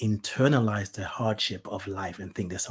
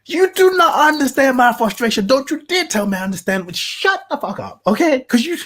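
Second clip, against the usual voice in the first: much higher and louder furious tirade; furious rant; 14 semitones higher, 9 dB louder than usual. Retold or imitated. Caller telling off call center agent, furious. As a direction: let the frustration boil over.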